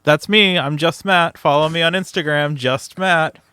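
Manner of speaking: bold, hammy self-introduction; loud playful intro. Real, not acted. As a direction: announce yourself like a showman.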